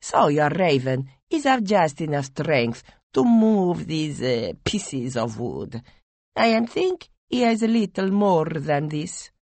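put-on lightly accented, amused; downplay the god with mild scorn; a hint of accented Dipeju. Dipeju mocks the Raven.

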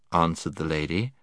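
usual speaking voice

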